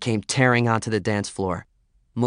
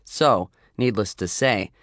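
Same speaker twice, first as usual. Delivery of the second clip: ordinary delivery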